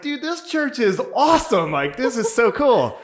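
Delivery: high, loud gushing; thrilled. Retold or imitated. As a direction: rave about it at full excitement. Acts out delight at church.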